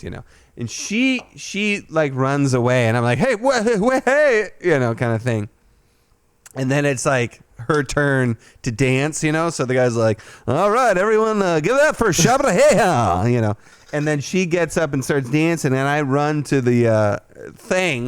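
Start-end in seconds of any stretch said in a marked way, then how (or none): 3.2-4.5 high, sputtering hey after her; startled call. Retold or imitated. Teller calling after the fleeing stripper, sputtering high. 10.5-13.2 high, loud hype-man announcement; club announcer. Retold or imitated. DJ introducing the stripper to the crowd, hyped announcer.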